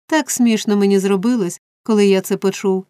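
plain reading voice

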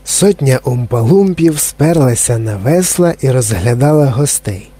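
plain reading voice

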